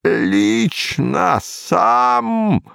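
put-on very slow, strained old voice; very old man. A narrator's reading. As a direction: drag it out like a frail old man.

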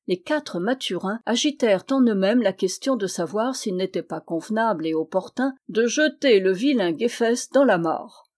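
ordinary delivery

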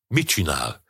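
mildly appalled exclamation; aghast cry. A narrator's reading. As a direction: ask in stunned disbelief. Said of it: "kiáltotta Bill megrökönyödve".

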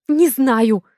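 yelling. A narrator's reading. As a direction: yell it while frantically searching. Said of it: "Ору, пытаясь найти".

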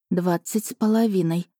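plain reading voice

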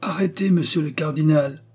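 high, resounding call; high-pitched clarion order; ringing shout. A narrator's reading. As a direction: proclaim the arrest so it carries down galleries. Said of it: "cria d'une voix éclatante".

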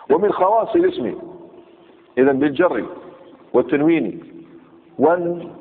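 ordinary talk, nothing marked

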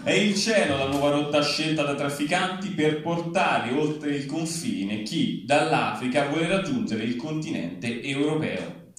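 usual speaking voice

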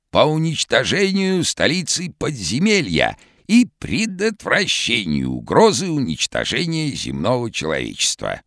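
hoarse, very high cartoon shout; vintage cartoon voice. A narrator's reading. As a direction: call out like an old cartoon character. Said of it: "хриплым, как в старом мультике".

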